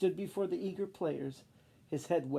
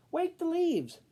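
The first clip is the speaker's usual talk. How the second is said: high, loud command; commanding call; 13 semitones higher, 6 dB louder, slower than usual. Acted. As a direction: call out the order boldly. Wadney commands, voice stronger.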